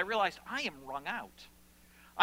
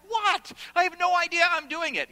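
loud, high-pitched bewildered denial; mock innocent protest; 11 semitones higher, 9 dB louder than usual. Dad defending himself to his family, mock indignant. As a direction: play up clueless innocence at top pitch.

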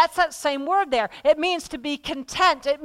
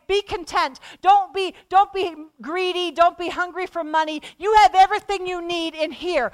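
higher and louder, pressing "Don't be"; urgent exhortation; 6 semitones higher, 4 dB louder than usual. Real, not acted. Preacher voicing God telling believers to be content, urgent. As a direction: urge them hard, stressing "Don't".

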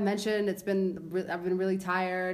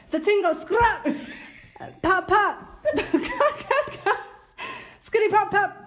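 high, loud rap lyric through laughter; laughing recital; 11 semitones higher, 4 dB louder than usual. Real, not acted. Host rereads rap proverb to co-host, cracking up. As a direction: fight the giggles while reciting. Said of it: "I can't even say this without laughing".

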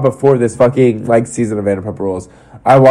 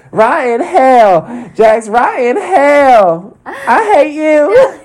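high, drawn-out mock wail; Brittany's outburst; 14 semitones higher, much slower than usual. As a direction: curse him in a hammed-up, high, slow howl. Mimics Brittany's outburst at Jax.

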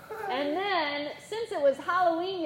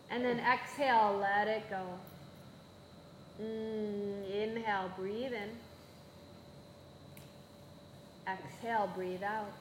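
guided breathing; 6 semitones lower, 10 dB quieter than usual. Acted. Guided breathing in yoga class.

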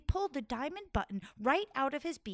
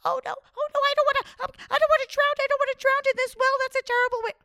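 high, louder fearful squawk; panicked rooster; 13 semitones higher, 10 dB louder, much faster than usual. Acted. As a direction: beg in panic not to drown. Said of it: "He was like".

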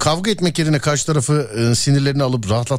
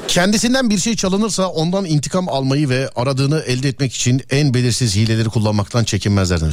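ordinary talk

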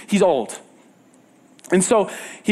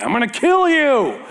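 a little sibling's whiny threat, higher; childish sibling threat; 8 semitones higher than usual. Retold or imitated. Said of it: "like your little brother or sister and saying".

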